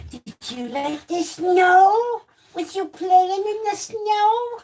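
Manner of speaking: high, drawn-out cooing questions. Real, not acted. Owner asking dog about snow, in baby talk.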